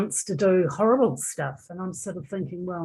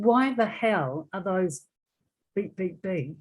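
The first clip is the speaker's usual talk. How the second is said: ordinary talk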